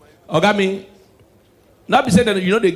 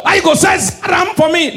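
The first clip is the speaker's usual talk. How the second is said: high, loud protest; indignant shout; 10 semitones higher, 4 dB louder than usual. Acted. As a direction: shout it high like an outraged customer. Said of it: "Why you dey shout?".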